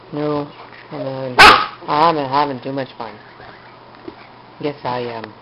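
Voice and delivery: low playful voice. Real, not acted. Low playful talk to puppy.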